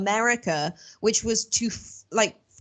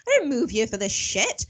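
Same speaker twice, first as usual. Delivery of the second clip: indignant retort; 9 semitones higher than usual. Retold or imitated. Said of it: "I'm just like".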